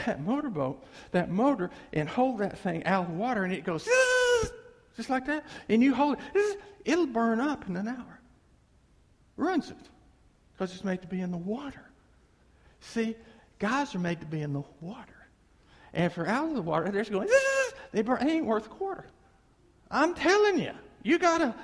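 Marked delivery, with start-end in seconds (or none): 3.8-4.5 high buzzing whine, drawn out; motor whine. Retold or imitated. Preacher imitates a motor out of water whining. 17.3-17.7 high buzzing whine, drawn out; motor whine. Retold or imitated. Preacher imitates a motor out of water whining.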